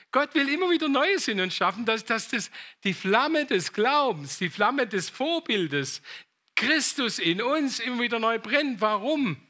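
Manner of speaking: emphatic sermon climax, key phrases drawn out; preaching. Real, not acted. Preacher urging the church to keep faith burning, emphatic preaching. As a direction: proclaim it with force to the congregation.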